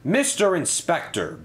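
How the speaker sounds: high-pitched, mildly booming summons. A narrator's reading. Controller confronts the fleeing inspector, booming. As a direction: call him to account with authority. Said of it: "boomed Sir Topham Hatt".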